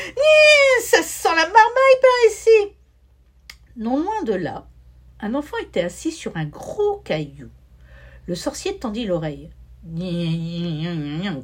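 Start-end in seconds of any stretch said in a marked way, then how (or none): 0.1-2.8 shrill shouted cry, much higher and louder; wizard's shriek. A narrator's reading. Wizard smelling children nearby, shrieking in a much higher, louder voice. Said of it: "Il poussa un cri". 9.9-11.4 lightly voiced low grumble; grumbling wizard. A narrator's reading. Wizard overhearing the child, grumbling in a lightly voiced low mutter. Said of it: "grommela-t-il".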